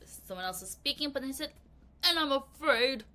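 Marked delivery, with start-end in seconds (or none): 2.0-3.0 lightly put-on man's voice; a hint of a gruff man; mild man impression. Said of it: "That's my man voice".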